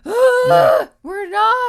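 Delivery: high, shouted mock outrage; hammed-up protest. Vapers denying they are radical, hammed-up shriek. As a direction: yell it high like a mock fanatic. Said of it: "And we're like".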